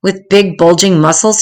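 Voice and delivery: plain reading voice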